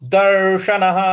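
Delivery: दर्शनः with the tongue rolled on र and a loud finish. Shown as a demonstration. Teacher shows students how to roll the tongue. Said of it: "जीभ को थोड़ा सा रोल करके बोलेंगे".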